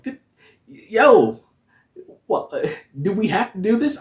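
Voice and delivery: high, loud startled yelp; shocked. Retold or imitated. Fan shocked by Wanda's horror scene, yelping high and loud. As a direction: recoil in shock at the screen.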